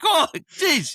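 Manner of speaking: high surprised exclaim; sudden find. Retold or imitated. Finding the walker.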